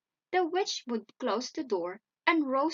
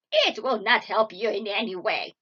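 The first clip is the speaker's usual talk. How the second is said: loud, gloating witch voice; scornful witch; 8 dB louder than usual. A narrator's reading. Witch answers weeping Gretel, loud gloating scorn. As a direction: taunt her loudly. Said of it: "said the witch".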